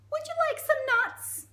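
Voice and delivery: very high, loud, inquisitive squeak; monkey voice. Acted. Monkey quizzing the crocodile, high squeaky voice. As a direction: pipe up like a nosy monkey.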